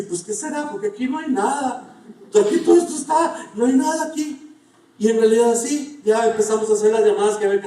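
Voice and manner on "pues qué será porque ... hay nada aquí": amused, higher voice, chuckling through it; wife laughs off the empty desert, lightly voiced; laughing wife. Wife joking about the breakdown, a hint of laughter.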